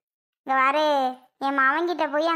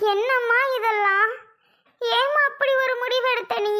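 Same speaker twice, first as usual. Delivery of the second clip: high-pitched, crying plea to mother; upset, weeping protest; tearful pleading; 6 semitones higher than usual. Acted. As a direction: plead with her through tears. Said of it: "ரெண்டு இப்படி அழுதுட்டு இருக்கு".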